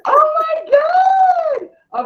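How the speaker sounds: high, loud cry of joy, drawn out slowly; joyful holler. Real, not acted. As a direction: let out a real shout of excitement. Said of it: "I wanna holler".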